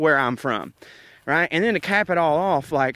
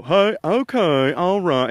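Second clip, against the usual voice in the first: slow, higher, louder folksy TV host; Doctor Phil impression; 4 semitones higher, 3 dB louder, slower than usual. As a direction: ease into a Doctor Phil drawl. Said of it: "an impression of Doctor Phil".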